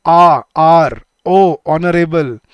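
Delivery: आ, आर, ओ, ऑनरेबल with the first vowel stretched loud; sound demo. Shown as a demonstration. Teacher showing the vowel sound in आर and ऑनरेबल, slow and loud. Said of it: "sound देखो क्या है".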